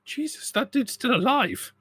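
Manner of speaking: high-pitched, drawn-out disbelief; astonished in-character outburst, voice way up; acted surprise. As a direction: exclaim it slowly, high, in astonishment. Player blurts in character's surprise.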